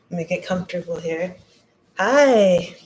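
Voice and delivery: usual speaking voice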